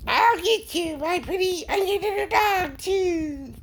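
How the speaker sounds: very high, cackly witch voice; Wicked Witch of the West. Retold or imitated. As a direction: shriek it like the Wizard of Oz witch. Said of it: "witch from Wizard of Oz".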